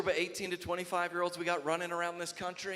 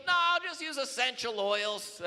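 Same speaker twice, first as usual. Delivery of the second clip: put-on whiny young soldier; 10 semitones higher, 5 dB louder than usual. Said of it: "They'll be like".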